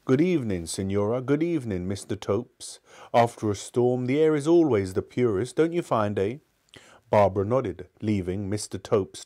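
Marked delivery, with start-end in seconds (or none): none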